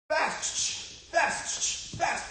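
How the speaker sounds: short, high, sharp cues; quick punchy bursts of "Fast". Shown as a demonstration. Coach cueing kids' quickest punches, fast. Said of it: "when I say fast, you're gonna do your quickest punch".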